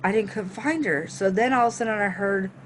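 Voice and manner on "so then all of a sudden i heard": ordinary delivery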